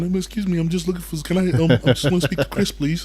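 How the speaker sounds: put-on high, timid squeak of a nervous kid; little squeaky voice. Nervous skinny kid asking gym reception for Chris, squeaky. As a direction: ask shyly, voice squeaking up high. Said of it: "have this little squeaky voice going".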